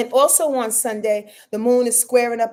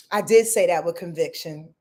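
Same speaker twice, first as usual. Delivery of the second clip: ordinary delivery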